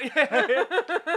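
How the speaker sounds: loud burst of laughter. Real, not acted. Laughs at own joke.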